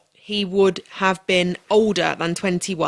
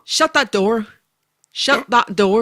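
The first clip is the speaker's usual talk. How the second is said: put-on higher-pitched Northern Irish accent; 3 semitones higher, 4 dB louder, slower than usual. Guest repeats catchphrase on demand, Northern Irish accent. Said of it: "as a Northern Ireland person".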